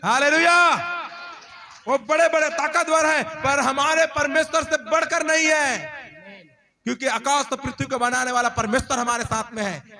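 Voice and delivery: high, loud proclamation to the congregation; full-force preaching. Real, not acted.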